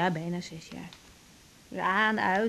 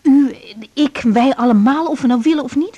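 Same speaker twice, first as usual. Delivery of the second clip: raised urgent reassurance; 9 dB louder than usual. Acted. Reassuring guilt-ridden mother by phone.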